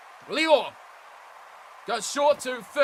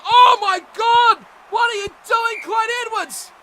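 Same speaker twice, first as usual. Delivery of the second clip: exasperated shout; 9 semitones higher, 11 dB louder than usual. Real, not acted. Commentator yelling at Clyde Edwards' blunder, exasperated shout. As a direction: yell at the player for his blunder.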